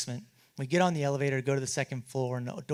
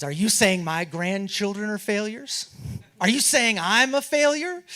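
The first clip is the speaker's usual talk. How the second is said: slow, rising, louder indignation; higher and louder, slow indignant challenge; indignant outburst; 6 semitones higher, 7 dB louder than usual. Retold or imitated. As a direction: build from hurt to outrage, slow. David dressing down visitors.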